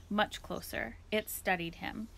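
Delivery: ordinary delivery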